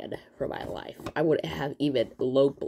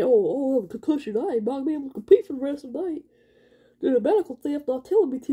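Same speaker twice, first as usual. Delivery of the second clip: mock announcer; 11 semitones higher, 7 dB louder than usual.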